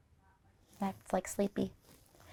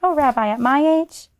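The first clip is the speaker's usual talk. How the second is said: put-on bashful old woman; 6 semitones higher, 5 dB louder than usual. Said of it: "Baba Brina blushed".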